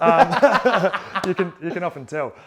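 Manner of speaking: laughing it off, caught out. Real, not acted. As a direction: talk through a real laugh after being caught. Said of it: "you're lying".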